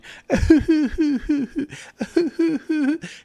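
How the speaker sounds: high, staccato fake sobs; mock crying. Son teasing his teary dad, mock crying. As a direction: whimper in pulses, making fun of someone crying. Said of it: "like, a crying noise".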